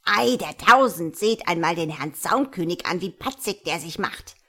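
a loud sneering burst that sinks down; mock the upstart wren with contempt; snooty birds sneering, loud start; scornful jeering. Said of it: "zischelten sie".

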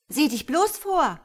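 high, loud warning; angry snarl. Acted. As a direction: snap back in irritation. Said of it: "faucht Zachariella".

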